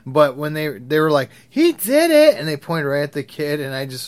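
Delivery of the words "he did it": put-on high, loud kid's accusing cry; retold accusation. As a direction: point the finger and yell it like a kid. Kids accusing a classmate.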